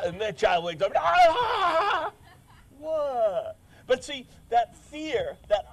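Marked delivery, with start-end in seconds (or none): 1.0-2.1 high startled cry of waking; startled scream. Retold or imitated. Preacher acting the woken child, high startled scream.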